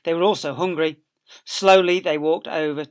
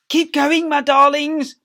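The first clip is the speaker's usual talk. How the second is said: high, loud, out of breath; panting Mister Fox; 12 semitones higher, 11 dB louder than usual. Acted. Mister Fox urging his family on while panting. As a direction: push the words out between pants. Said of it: "panted Mister Fox".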